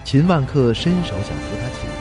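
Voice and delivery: usual speaking voice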